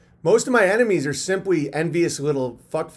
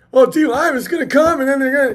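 high, louder fretful voice; mock paranoid panic; 10 semitones higher, 7 dB louder than usual. Mocking people who spiral about DLive, panicky. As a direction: play a panicky worrier.

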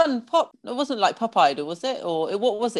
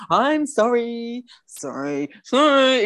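strangled, drawn-out mock apology; imitating someone who can't mean an apology; insincere apology; 3 semitones higher, much slower than usual. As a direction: drag out sorry like it won't come out. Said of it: "Just say it like you mean it".

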